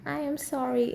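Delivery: a fond, gently mocking Russian-accented apology. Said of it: "in his Russian accent".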